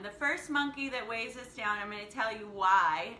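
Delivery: usual speaking voice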